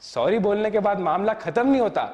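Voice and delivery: ordinary delivery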